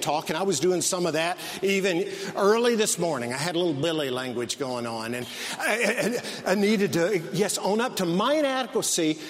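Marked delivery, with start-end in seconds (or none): 3.3-7.2 chuckling through the words; laughing. Real, not acted. Preacher laughing about his own negative self-talk. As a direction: laugh at yourself as you admit it.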